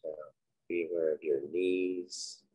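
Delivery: unhurried, quiet cues for breath and body; guided meditation. Acted. Leading listeners through breathing and a body scan, guided meditation.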